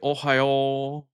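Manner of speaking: "Ohio" in a mock Japanese accent, last O drawn out; imitated Japanese accent. Streamer mimicking the TTS bot's attempt, Japanese accent. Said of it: "tried to even say it in an accent".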